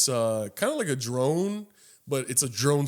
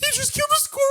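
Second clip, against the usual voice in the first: put-on much higher childlike accusing squeal; little sisters accusing; much faster than usual. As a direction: play the shocked kid sisters. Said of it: "They were just like".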